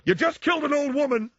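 high, strident mock father scolding; imitating dad. Dad scolding his son after a parking mistake, high and shrill. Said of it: "My dad would go".

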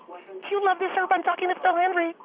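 high-pitched, thrilled showing off; giddy excitement. Acted. Caller telling her husband she's on with the radio host, giddy. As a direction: gush it up high like a starstruck fan. Gushing to husband about being on air.

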